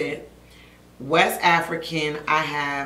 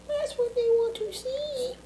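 high playful voice; 4 dB quieter than usual. Pretend.

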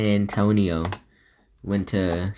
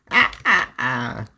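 startled yelps; 3 semitones higher, 5 dB louder, slower than usual. Real, not acted. Startled in game.

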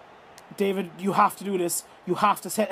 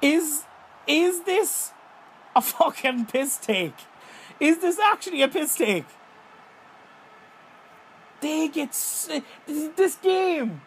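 exasperated outrage; 6 semitones higher than usual. Real, not acted. Outraged at the game.

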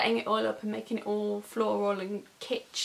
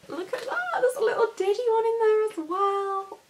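higher, cooing at the tiny one; high cooing delight; 10 semitones higher, 3 dB louder than usual. Real, not acted. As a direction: gush over how small it is. Finds tiny suitcase.

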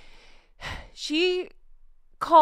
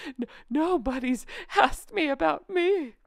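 mock Meghan voice; 4 semitones higher than usual. Mocking Meghan's complaint.

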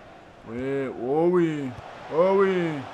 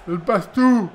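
louder excited repeat; 3 semitones higher, 6 dB louder, slower than usual. Real, not acted. Repeats dribble remark louder.

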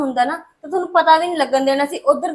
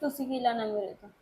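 much softer, lower sulk; sulky mutter; 6 semitones lower, 13 dB quieter than usual. Acted. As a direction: mutter it resentfully. Sulking daughter mutters.

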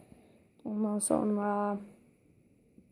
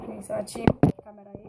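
ordinary delivery

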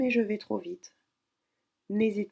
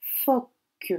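Phoque said higher and louder; dictated word; 5 semitones higher, 6 dB louder than usual. Shown as a demonstration. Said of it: "dictée". (Phoque dicté clairement)

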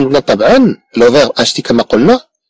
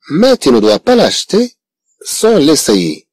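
slow model reading; 3 semitones higher, much slower than usual. Shown as a demonstration. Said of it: "teacher models slow French sentence".

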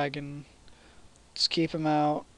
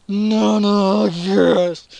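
real, very loud celebratory yell; explosive victory shout; triumphant shout; 6 semitones higher, 15 dB louder, much slower than usual. Real, not acted. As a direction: cheer it at full volume, higher. Cheering a hit in game.

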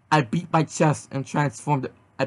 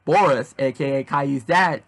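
usual speaking voice

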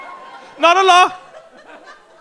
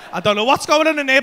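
usual speaking voice

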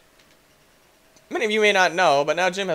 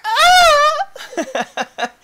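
very high, loud cartoon scream; hammed-up scream while plummeting; falling scream; 9 dB louder than usual. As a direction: scream as you fall away.

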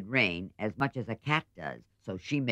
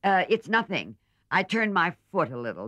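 put-on higher, brighter girl's voice covering pain; Meg speaking aloud; 9 semitones higher, 5 dB louder than usual. A narrator's reading. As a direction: brush it off lightly, voice raised. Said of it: "adding aloud".

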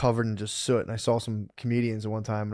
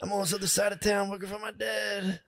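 higher, softer fake-modest guy; mock humble worker; 7 semitones higher, 4 dB quieter than usual. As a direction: play the humble working man.

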